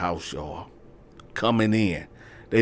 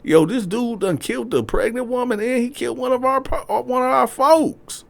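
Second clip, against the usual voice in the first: much higher, agitated; cop talking. Retold or imitated. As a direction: fill in the squad. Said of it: "They like".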